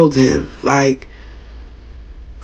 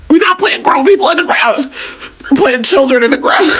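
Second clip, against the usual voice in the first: much higher, choked crying voice; tearful grief; 11 semitones higher than usual. Real, not acted. Mourning a child in the ground, crying. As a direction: say it as the tears take over. Grieving rant about child's killing.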